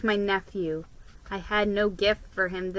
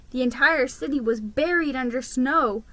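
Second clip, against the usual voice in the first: ordinary delivery, nothing marked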